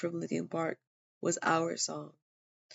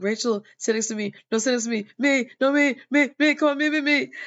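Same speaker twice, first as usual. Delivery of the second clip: higher, louder pleading for attention; clamoring "me, me" over each other; eager begging; 6 semitones higher, 8 dB louder than usual. Retold or imitated. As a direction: beg like kids fighting over a seat. Friends begging for her seat.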